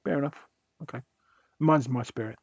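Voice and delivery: ordinary talk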